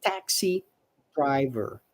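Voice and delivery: careful "taxi driver" for learners; model pronunciation. Shown as a demonstration. Teacher modeling taxi driver. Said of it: "So taxi pronunciation here".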